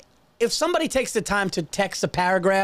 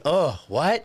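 ordinary delivery

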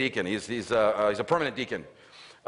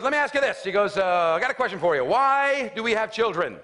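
high, louder mock-dad voice; earnest questioning father; 9 semitones higher, 4 dB louder than usual. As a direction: pose the big question, stretching it out. Retold father poses big question.

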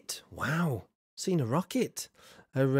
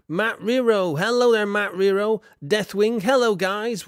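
big cheery hello, raised and loud; loud greeting; 7 semitones higher, 6 dB louder than usual. Real, not acted. Streamer loudly greeting a newly arrived viewer. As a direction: call out each name like a host.

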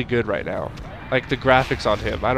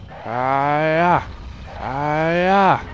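drawn-out kiai sliding upward; rising karate cry; 3 semitones higher, much slower than usual. Pretend. Gamer landing final hits, rising karate cries. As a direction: swell each playful attack cry upward.

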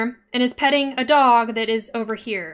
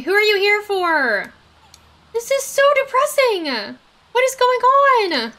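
high, loud, upset exclamations; distressed disbelief; 7 semitones higher, 5 dB louder than usual. Real, not acted. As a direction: wail in bewildered dismay. Losing animals in the game.

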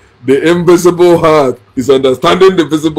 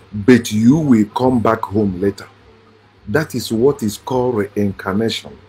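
lower, measured delivery with pauses; slow and low, phrase by phrase; slow explanation; 5 semitones lower, 3 dB quieter than usual. Shown as a demonstration. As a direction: spell it out patiently so they understand. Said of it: "say it again slowly so you understand".

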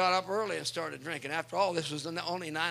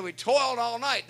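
loud, high call back from the boat; weary fishermen yelling to the shore; 7 semitones higher, 10 dB louder than usual. Acted. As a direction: shout back across the water.

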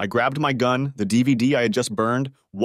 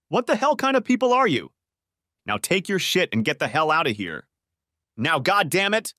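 higher-pitched, incredulous anger at normal volume; angry accusation, pitched up without shouting; indignant confrontation; 7 semitones higher than usual. A narrator's reading. Husband confronts wife who plotted against him, controlled indignation.

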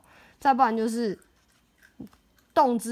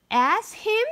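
scoffing; 11 semitones higher, 4 dB louder than usual. Acted. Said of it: "mayor scoffs".

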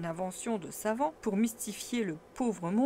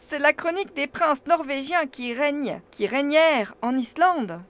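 loud, eager lecturing professor; proud scholar showing off his book; animated uncle; 4 semitones higher, 6 dB louder than usual. A narrator's reading. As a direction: declaim it with growing excitement. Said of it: "en s'animant".

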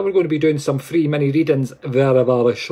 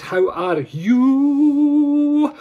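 sing-song greeting; 11 semitones higher, much slower than usual. Acted. Greeting viewers, stretched high.

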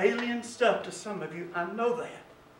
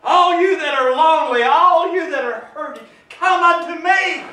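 scripture cried out, rising to a higher pitch; building call; 6 semitones higher, 8 dB louder than usual. Acted. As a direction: call out to the hurting.

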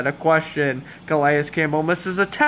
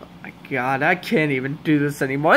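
ordinary delivery

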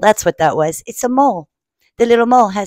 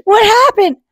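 high, loud mock surprise; surprised question; 12 semitones higher, 5 dB louder than usual. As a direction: squeak it out as if baffled.

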